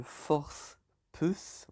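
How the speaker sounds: slow, drawn-out English accent on the name; mock-magnificent English accent. Shown as a demonstration. Streamer announcing the opponent to viewers, in a showy English accent. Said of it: "Magnifique accent, n'est-ce pas?".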